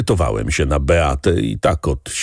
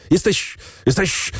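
sputtering, much higher, choking on the word; choking with rage; 12 semitones higher than usual. A narrator's reading. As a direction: choke on the words in utmost rage. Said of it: "krztusił się w pasji najwyższej". (krztusi się z wściekłości, dużo wyżej)

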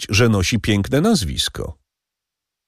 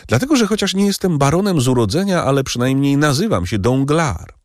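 higher, fast, puffed-up boast; proud banker Danglars; 7 semitones higher than usual. Acted. Danglars boasting of his name to the count, higher and fast. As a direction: rattle off your pride in the name.

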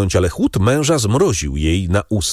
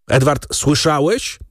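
sharp, higher-pitched rebuke; mildly raised, stern narrated cry; 8 semitones higher than usual. A narrator's reading. Father sharply calling his son to obey, mildly sharp cry. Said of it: "krzyknął pan de Villefort tak ostro".

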